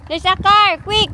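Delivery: loud, high warning shout; real alarm, shouting a warning; urgent shout. Real, not acted. Urgent warning about a car.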